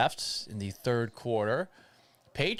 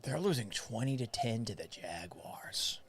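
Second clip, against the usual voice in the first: hushed whisper of bad news; whispered score update in dismay; 9 dB quieter than usual. Real, not acted. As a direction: breathe it out with no voice, as if the loss is a secret. Dismayed aside about the score.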